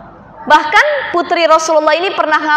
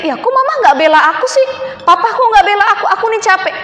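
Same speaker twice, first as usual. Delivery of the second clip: whiny daughter; 6 semitones higher, faster than usual. Retold or imitated. Whiny modern daughter imitated.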